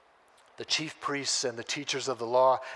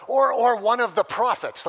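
loud, unsure blurt; louder hedge, covering his bases; disciple covering bases; 6 semitones higher, 10 dB louder than usual. Retold or imitated. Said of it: "the third person goes".

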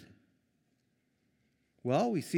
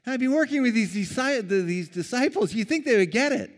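exasperated Jesus; 11 semitones higher, 6 dB louder than usual. Retold or imitated. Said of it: "Jesus knows, he says".

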